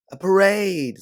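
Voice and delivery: high, loud delighted squeal; girl spotting a parade, squealing with delight; excited squeal. Acted. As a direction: squeal with thrill at the sight. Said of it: "Sabine squeals".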